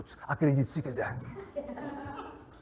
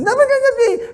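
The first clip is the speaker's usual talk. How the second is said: high mock squeal; 9 dB louder than usual. Arguing back and forth.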